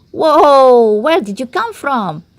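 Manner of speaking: startled shout at the stranger; exclaiming. A narrator's reading. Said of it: "exclaimed the leader of the flock".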